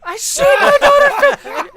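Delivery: very high, loud, laughing mock scream; horrified shriek. Dad realises what he did to daughter, mock-horrified shriek. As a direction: shriek it in hammed-up horror. Re-enacts his horror, traumatized.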